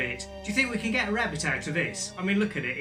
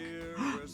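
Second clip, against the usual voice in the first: sharp gasp; 8 dB quieter than usual. Acted. Rabbit appears from the hat.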